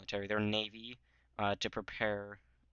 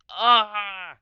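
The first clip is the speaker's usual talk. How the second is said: mock scream; 11 semitones higher, 15 dB louder than usual. Russians as Germans reach Petersburg.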